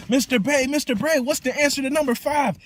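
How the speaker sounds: put-on very high, insistent child's voice; pushy kid pestering teacher, squeaky; eager kid calling. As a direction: tug at the teacher with a squeaky plea. Kid tugging coattail voiced.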